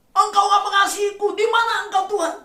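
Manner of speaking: high, loud accusing cry at God; mock accusing cry. Preacher mimics a believer crying out at God, high and loud. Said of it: "jangan kita berkata".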